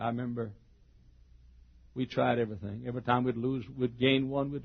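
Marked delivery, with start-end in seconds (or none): none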